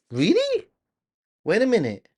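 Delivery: very high rising really; surprised. Real, not acted. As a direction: pop up high in delighted disbelief. Learning a viewer is from Nagoya.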